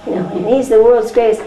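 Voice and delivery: ordinary talk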